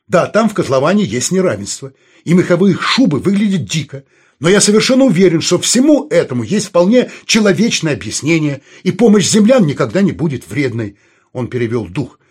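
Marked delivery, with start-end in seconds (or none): 0.1-11.0 louder, higher, insistent argument; impassioned rebuttal. Acted. Vadim defending help from Earth, in a raised, insistent voice. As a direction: press the case with raised voice. Vadim argues back in dialogue.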